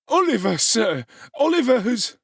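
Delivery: high, loud urgent blurting; breathless tattling. Acted. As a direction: rush to tell on Oliver. Noah reporting in feigned panic.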